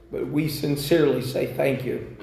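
normal talking voice

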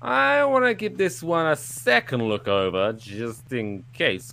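high, drawn-out mock objection; second-guessing officer. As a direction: stretch it out as you interrupt. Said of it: "someone was like".

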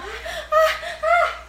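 high mock panic gasps, laughing; very high hammed-up panic breaths; panicked gasps. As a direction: pant like someone panicking. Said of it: "Como entró en pánico".

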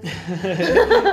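loud laugh; laughing at the joke; burst of laughter. Real, not acted. Laughing at a friend's joke about sad social media.

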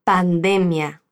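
"pandemia" with the stress on "de", pan-DE-mia. Shown as a demonstration. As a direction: clear model of "pandemia", stress on "de".

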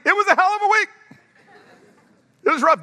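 high, loud mock venting; unmasked outburst. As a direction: blurt the honest answer with no mask on. Acted unmasked answer about week.

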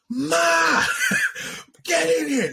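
high-pitched yell for Ma; retold scream. Telling friend how he called his ma in, high retold scream. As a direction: re-enact screaming out to your mother. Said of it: "I screamed. I was like".

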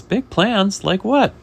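high, loud questioning; acted scoffing disbelief; incredulous chef. Chef challenging the fish's big plans, acted incredulity.

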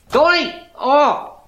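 high, loud cry of frustration; thwarted, exasperated shout; exasperated yell. Acted. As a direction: cry out as he ignores you. Donnie ignores his plea.

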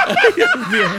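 high burst of laughter into the words; giggling exclamation; laughing. Real, not acted. Laughing at co-host's outburst.

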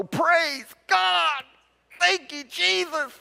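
high cry of praise through pain; joyful cry. Acted. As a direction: shout praise as the bone is set. Uncle Bud cries out in pain.

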